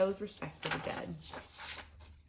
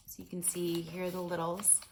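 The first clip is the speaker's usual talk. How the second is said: usual speaking voice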